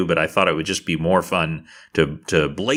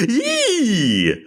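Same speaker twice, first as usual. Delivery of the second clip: high, drawn-out squeal through a grin; childlike gleeful squeal. Acted. Tovar seeing Mister Clicks restored, high childlike squeal. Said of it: "almost childlike grin and goes".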